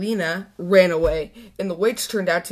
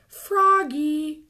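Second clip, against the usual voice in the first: calling out; 8 semitones higher than usual. Acted. Teacher calls out Froggy.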